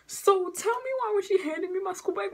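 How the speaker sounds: high, fast mock scolding; mock angry mama. Teen mimicking her mama's angry demand, high and fast. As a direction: rattle it off like an outraged mother. Mother's angry question, retold.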